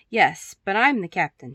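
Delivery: loud, proud declaration; a playful pirate girl crying out her rank; cried out. A narrator's reading. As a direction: proclaim it like the captain. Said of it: "she cried out".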